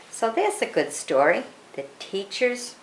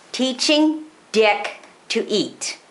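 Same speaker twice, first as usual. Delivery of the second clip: title drawn out slow and high; slow, weighty announcement of the title; emphasized title; 4 semitones higher, slower than usual. Real, not acted. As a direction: lean hard on every word of the title. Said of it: "you put a lot of emphasis on that".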